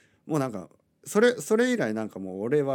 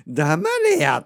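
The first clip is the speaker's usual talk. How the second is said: a louder Kansai-dialect "shut up" repeated; mock Kansai dialect, louder; mock Kansai snap; 4 semitones higher, 7 dB louder, much slower than usual. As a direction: repeat the rough Kansai "shut up", half joking. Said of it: "関西弁で言いたくなるぐらいの".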